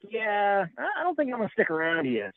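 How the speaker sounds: a mock bull backing off casually; voicing the bull. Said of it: "the bull was like".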